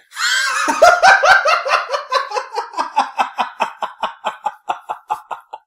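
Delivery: high shrieking burst of laughter; loud laugh. Real, not acted. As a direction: let out a big cackle. Joke setup lands, big laugh.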